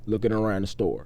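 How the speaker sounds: ordinary talk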